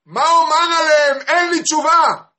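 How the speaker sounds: very high, loud outcry; anguished shout. Acted. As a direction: cry out on behalf of the people. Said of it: "ואוהב ישראל צועק". (זעקה בקול גדול)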